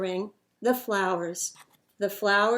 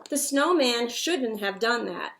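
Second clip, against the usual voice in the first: usual speaking voice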